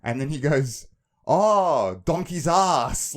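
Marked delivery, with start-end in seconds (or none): 1.3-3.1 loud, drawn-out moan, higher in pitch; sleep-talker. Retold or imitated. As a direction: imitate a loud, slow sleep-talking outburst. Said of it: "like that in his sleep".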